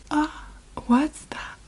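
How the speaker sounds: slow surprised whisper; hushed alarm at what she finds. Real, not acted. Finding blood on body.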